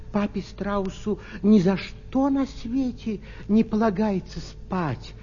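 ordinary delivery, nothing marked

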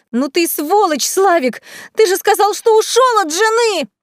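high-pitched, rising outrage; furious accusation. A narrator's reading. Furious lover accuses cheating man. (возмущённый крик высоким голосом)

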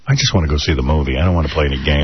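low grumbling complaint; mildly grumpy acted protest; retold reluctance. Dad wanting the movie instead of games, low grumble.

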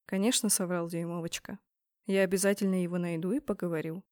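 soft, low tender comfort; tender reassurance. A narrator's reading.

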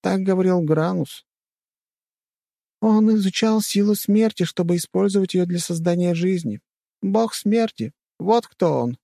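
high female voice reciting a revelation; high-pitched earnest girl; solemn sister. A narrator's reading. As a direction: explain it gravely in a high voice. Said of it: "добавила сестра".

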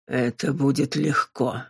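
low, slow demon voice; demon, casual menace. A narrator's reading. Demon answering Robin about killing, low and slow. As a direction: drop low and take your time.

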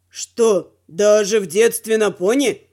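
low male teacher voice; teacher's question. A narrator's reading. Teacher questions inexperienced rider.

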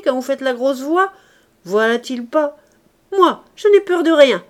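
put-on loud, high scornful girl laughing at the men; mocking defiance. Éponine mocks the bandits.

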